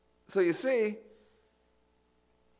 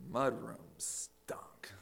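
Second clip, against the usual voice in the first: slow hushed whisper; 3 semitones lower, 8 dB quieter, much slower than usual. Retold or imitated. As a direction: drop to a whisper for the stench. Punchline of rat story.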